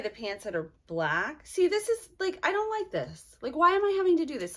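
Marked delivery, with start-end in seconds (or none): 1.4-4.6 higher, exasperated rant; complain, pitched up with annoyance; real irritation, voice up and quicker; irritated. Real, not acted. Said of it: "I'm very irritated right now".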